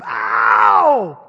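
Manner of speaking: low, loud yelp of pain. Retold or imitated. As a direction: bark out the hurt. Toothache pain outburst.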